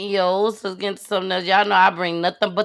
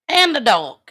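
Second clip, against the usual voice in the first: usual speaking voice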